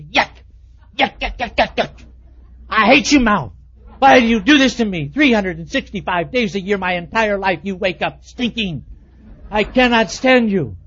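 loud, hammed-up yuck and rant at his own mouth; mock outrage at his own bad breath; mock disgust at himself. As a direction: scold your own morning breath in mock horror.

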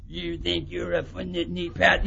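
low, slow, near-demonic voice; imitating an attacker's slow, eerie growl; menacing attacker's voice. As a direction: drop low and drag the words menacingly. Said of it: "his voice got real".

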